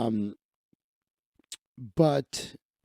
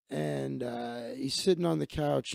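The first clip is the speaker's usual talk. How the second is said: ordinary delivery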